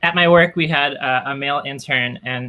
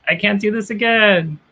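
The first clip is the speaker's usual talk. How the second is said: high, whiny give-up wail; higher hammed-up anguish; mock despair; 8 semitones higher than usual. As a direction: whimper like you're about to quit. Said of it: "It's just like".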